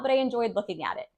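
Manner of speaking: ordinary talk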